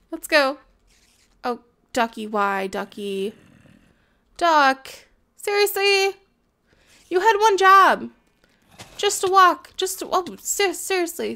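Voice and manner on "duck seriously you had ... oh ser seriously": high, loud frustrated yell; scolding a duck that fell off; exasperated scolding. Real, not acted. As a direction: tell off the useless duck.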